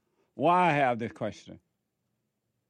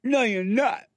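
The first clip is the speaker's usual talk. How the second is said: sharp mock denial, higher and louder; mock indignant snap; 8 semitones higher, 4 dB louder than usual. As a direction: snap back like a defensive listener. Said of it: "And you say".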